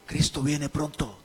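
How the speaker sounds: low, soft, unimportant-sounding delivery; understated murmur. Shown as a demonstration. Preacher shows the congregation a message without importance, understated murmur. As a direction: say it small, as if it didn't matter. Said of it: "Imagínate que yo le esté diciendo que aquí".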